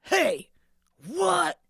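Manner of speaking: sudden loud startled bark; startled shout. Acted. The thief hears a sneeze, startled shout. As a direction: jump at the sound. Thief discovers someone hiding.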